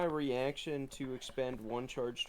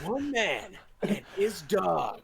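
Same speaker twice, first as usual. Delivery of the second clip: movie trailer voice; 6 semitones higher, 4 dB louder, slower than usual. Pretend. Trailer voice, slowed.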